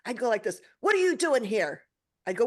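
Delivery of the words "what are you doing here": raised, indignant question at a dead father; retold angry demand. Daughter challenging her dead father in a dream, indignant. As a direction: confront him, louder and higher.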